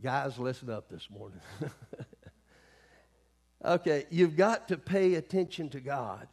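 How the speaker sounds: normal talking voice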